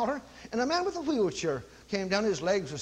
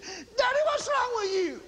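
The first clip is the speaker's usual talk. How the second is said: put-on teen daughter; 13 semitones higher than usual. Daughter voiced in story.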